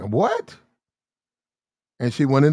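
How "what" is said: high, sharply rising mock disbelief; incredulous what. Woman stares back at her yelling man, mock-incredulous. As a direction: swoop up into an incredulous question. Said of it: "she just looked at him, right, like".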